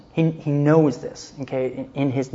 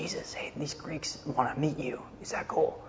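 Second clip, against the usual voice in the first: softer whispered aside; 10 dB quieter than usual. Retold or imitated. Disciples quietly asking Jesus about the Greeks, whispered. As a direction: lean in and whisper the question.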